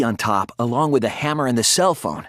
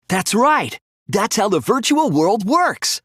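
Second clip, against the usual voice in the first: higher, bursting with realization; eureka; 7 semitones higher than usual. Acted. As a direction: it all clicks into place. Realizing the trick in trial.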